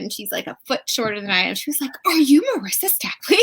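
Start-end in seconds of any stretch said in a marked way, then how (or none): 2.0-3.4 high, loud imitation of an excited Irish woman; retold question in a light Irish accent; cute Irish accent. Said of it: "her cute little Irish accent".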